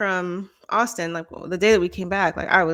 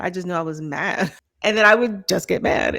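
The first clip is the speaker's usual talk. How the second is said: ordinary talk, nothing marked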